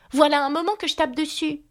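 put-on high, impatient girl's outcry; impatient cry. A narrator's reading. Alice exclaims impatiently to the frog, high. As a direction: exclaim it with exasperation. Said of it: "s'écria Alice impatientée".

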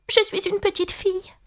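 put-on high, tentative little-girl voice; timid, unsure answer; hesitant. A narrator's reading. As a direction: offer it uncertainly, pitched high. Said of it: "dit Alice d'une voix hésitante".

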